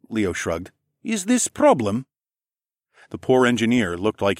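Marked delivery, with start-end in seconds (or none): 1.0-2.1 high-pitched alien voice; high-voiced offworlder, puzzled; Leo. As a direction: ask innocently in halting foreign English, pitched up. Alien Leo answers in broken English.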